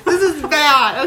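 laughing at her own bad accent. Real, not acted. Embarrassed by her accent, laughing it off. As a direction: giggle through the words. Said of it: "that was fantastic".

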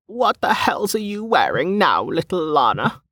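put-on high-pitched old street vendor calling across; old woman calling. A narrator's reading. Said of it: "came a voice from the other side".

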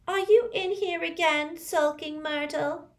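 high taunting schoolgirl; mocking bully voice. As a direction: sneer it in a nasty sing-song. Olive Hornby quoted by Myrtle.

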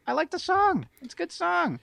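high put-on voice. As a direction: squeak it out in a high voice. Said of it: "And he's like".

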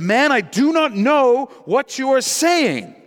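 high, loud acted protest; vehement denial. Peter's heated third denial.

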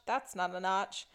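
understated Crocodile Dundee parody, dismissive; movie-line parody. Retold or imitated. Host quoting a man inspecting a tree, understated parody. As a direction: toss off THAT'S as a quick rejection. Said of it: "pop culture reference here where he's like".

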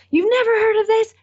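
a friend playing up the shock that she has never heard of it; growing disbelief. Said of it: "And they're like".

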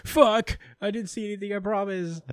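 high panicked protest, caught looking; mock panic in a high voice; flustered Asta. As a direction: squeak out a flustered denial. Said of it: "It's like".

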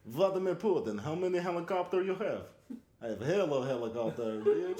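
Russian strongman bragging; Russian accent. Retold or imitated. Putin interview bit.

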